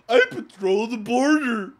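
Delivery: slow, high, booming boast; very loud, hammed-up officer voice; mock border agent. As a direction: proclaim it like a ridiculous self-important officer. Mocking the officer's self-importance.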